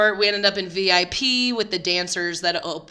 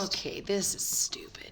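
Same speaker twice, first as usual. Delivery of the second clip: whispering; 7 dB quieter than usual. Real, not acted. Hushed aside moving on.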